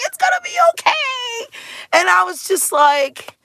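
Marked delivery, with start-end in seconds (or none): 0.1-1.5 reassuring staff. Retold or imitated. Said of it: "They're just like".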